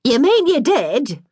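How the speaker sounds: slow, loud, high outraged question; shocked disbelief. Acted. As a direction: stretch it out in disbelief. Eyes flew wide open, shocked.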